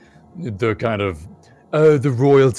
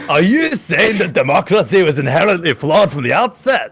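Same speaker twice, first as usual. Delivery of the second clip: higher, louder, pompous question; mock pompous student; 9 semitones higher, 9 dB louder than usual. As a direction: parody the keen student.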